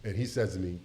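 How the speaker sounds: ordinary talk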